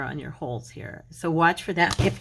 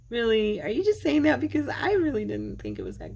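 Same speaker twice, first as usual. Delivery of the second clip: high, loud disbelieving Really; mock disbelief at the compliment; doubtful surprise; 6 semitones higher, 3 dB louder than usual. To viewers who praise her crafts, loud incredulous doubt. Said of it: "and I'm like".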